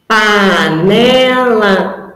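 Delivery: pa-ne-la, each syllable spaced out slowly; slow syllable-by-syllable panela; syllables split apart. Shown as a demonstration. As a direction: break panela into its syllables for a child. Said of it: "essa palavra tem quantos pedacinhos".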